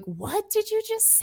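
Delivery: high, puzzled city-folk voice; baffled city person. Retold or imitated. City person baffled by small-town weirdness, high and puzzled. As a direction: play a confused outsider hearing something odd. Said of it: "people in the city would be like".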